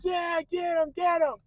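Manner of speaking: high, excited egging-on; cheering crowd. Retold or imitated. Soldiers cheer their giant toward Achilles, mock excited cheering. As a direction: cheer your champion on. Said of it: "They're like".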